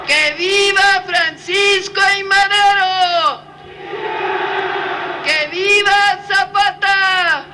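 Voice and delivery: rally cry, each viva hammered out; ranting dictator speech. Real, not acted. Closing patriotic cries of viva.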